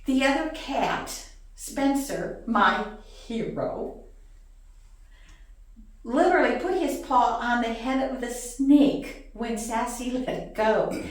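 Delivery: ordinary delivery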